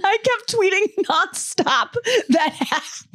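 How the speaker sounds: can barely get the words out for laughing; reading a text aloud to a friend, cracking up; laughing while reading. Real, not acted. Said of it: "he said".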